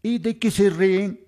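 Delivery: a teacher snapping at laughing colleagues; stern teacher. Retold or imitated. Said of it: "uyaramusqa riki chay q'uqa profesora".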